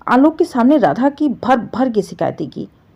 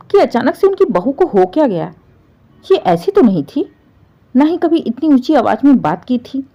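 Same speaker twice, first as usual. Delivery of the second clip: ordinary delivery, nothing marked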